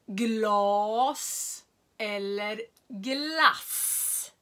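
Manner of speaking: glas and glass, drawn out very slowly side by side; slow contrast demo. Shown as a demonstration. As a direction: stretch "Glas eller glass" out slowly, long a then short a. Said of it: "Vi kan ta ett exempel".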